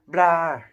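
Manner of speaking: loud spitting blah of disgust. Acted. Ron tasting a sprout bean, loud disgusted blah. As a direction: spit it out, yuck.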